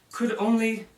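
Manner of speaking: plain reading voice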